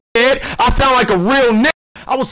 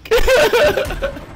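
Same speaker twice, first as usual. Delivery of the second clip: high-pitched taunting cackle; mocking cackle. Real, not acted. Player laughing at a weak rival, mocking cackle.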